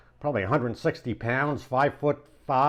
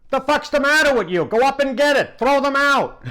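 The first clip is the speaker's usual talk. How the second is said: high, loud, fast tirade; angry yell; 12 semitones higher, 5 dB louder than usual. Retold or imitated. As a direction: chew out the landlord. Sal yelling at landlord.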